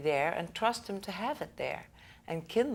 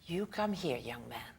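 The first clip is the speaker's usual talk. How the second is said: put-on soft, hushed, fond old grandma; whispered granny beckoning the boy; grandma's voice; 5 semitones lower, 5 dB quieter than usual. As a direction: coax him over like a doting grandmother. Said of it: "grandma talked like this".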